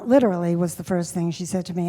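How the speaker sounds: ordinary talk, nothing marked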